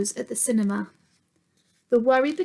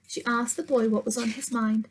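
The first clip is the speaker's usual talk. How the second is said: ordinary delivery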